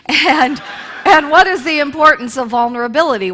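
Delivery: amused, laughing retelling. Real, not acted. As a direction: chuckle through the quote. Laughing while quoting news.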